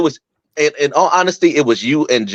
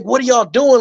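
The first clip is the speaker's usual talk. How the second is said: scolding; 12 semitones higher than usual. Retold or imitated. Retold scolding of fighting bandmates.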